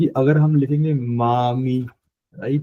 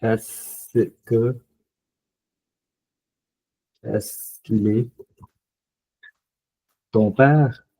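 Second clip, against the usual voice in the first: slow model dialogue; 2 semitones lower, much slower than usual. Shown as a demonstration. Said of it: "Other example।".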